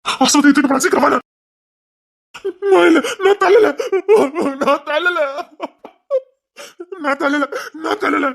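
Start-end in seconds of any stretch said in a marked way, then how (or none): 2.7-8.3 high, frantic denial fading slower; panicked denial. Acted. Father denying he pushed the boy, high frantic denial. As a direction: protest innocence in shock.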